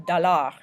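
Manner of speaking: French dollar as doh-LAR; French pronunciation. Shown as a demonstration. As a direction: say dollar the French way, stress on the end. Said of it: "we say the R at the end".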